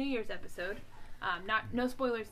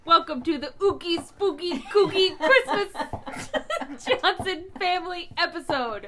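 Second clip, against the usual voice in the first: laughing intro; 5 semitones higher, 11 dB louder, slower than usual. Pretend. Spooky sing-song show title.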